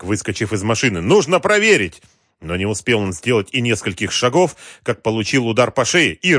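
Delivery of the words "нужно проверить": high, loud urgent call; urgent shout. A narrator's reading. Partner jumping out of the truck, urgent shout. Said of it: "крикнул напарник".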